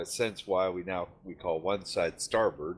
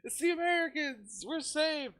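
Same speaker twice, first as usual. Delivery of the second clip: very high falsetto cheer; hammed-up squeal of relief; mock rejoicing. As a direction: pretend to hail rescuers in a tiny high voice. Mock celebration of rescuers sighted.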